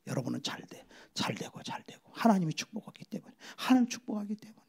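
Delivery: soft unvoiced whisper; whispered blessing. Real, not acted. Preacher whispering blessing over the congregation. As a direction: whisper it like a secret promise.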